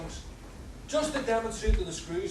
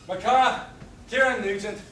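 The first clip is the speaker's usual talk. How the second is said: loud introduction; 3 semitones higher, 7 dB louder than usual. Acted. Prisoner introduces himself loudly.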